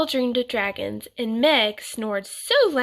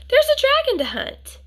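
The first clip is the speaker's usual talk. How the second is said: out-of-breath eager, much higher and louder; puffed; 6 dB louder than usual. A narrator's reading. Grandfather hurrying up the path, puffing high and eager. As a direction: puff it out high and eager mid-climb. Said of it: "puffed Grandfather".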